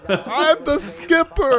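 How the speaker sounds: the Skipper. Acted. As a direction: belt it like a blustering sea captain. Skipper impression.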